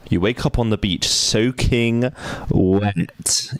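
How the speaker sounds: slow, dramatic narrator; story narration. Acted. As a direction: read it like a storyteller. Reads game narration.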